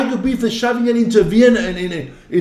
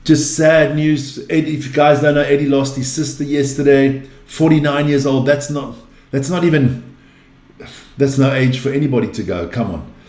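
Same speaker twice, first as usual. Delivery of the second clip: lower, gentle sympathy for a grieving friend; sincere condolences; 6 semitones lower than usual. Real, not acted. Host to grieving Eddie, lower and sincere sympathy. Condolences for his late sister.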